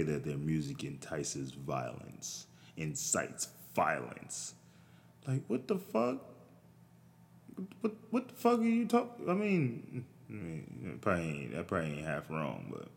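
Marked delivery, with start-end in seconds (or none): 5.3-10.0 much higher, disbelieving what the fuck; pitched-up outraged disbelief; incredulous squeak. Real, not acted. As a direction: voice jumps up in disbelief. Incredulous outburst at Chicago rappers ban.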